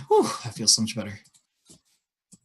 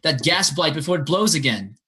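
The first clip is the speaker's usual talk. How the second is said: raised, higher-pitched warning; acted urgent yell; 7 semitones higher, 3 dB louder than usual. Player's character warns everybody about the gas blight, yelling. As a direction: urge everyone back, half yelling. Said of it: "he's gonna yell to everybody".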